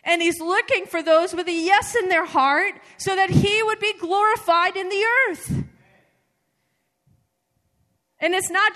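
loud, high preacher's call; preaching at full force. Acted. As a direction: proclaim it to the whole room. Preaching climax.

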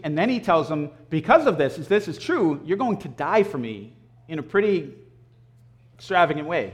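ordinary talk

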